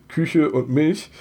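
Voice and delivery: Küche und Milch slow with Bremen ü vowels; Bremen Ü sounds. Shown as a demonstration. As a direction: stretch Küche and Milch with a rounded ü. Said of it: "meine Aussprache des Wortes".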